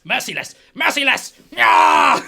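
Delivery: shouts building to a high yell; psyching himself up. Acted. Dwarf looking up at his foe, building war cry.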